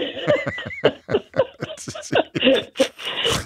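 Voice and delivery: long high burst of laughter; high peal of real laughter. Real, not acted. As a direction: crack up helplessly at the idea. Host cracks up at caller.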